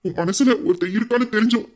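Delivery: ordinary talk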